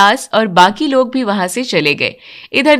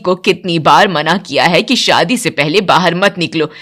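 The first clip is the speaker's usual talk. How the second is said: lower, grumbling voice of a strict mother-in-law; stern mother-in-law; 5 semitones lower than usual. Acted. Mother-in-law grumbling about her daughter, lower stern voice. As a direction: complain like a strict old matriarch.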